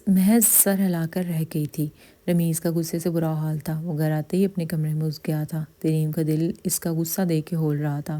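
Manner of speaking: ordinary delivery, nothing marked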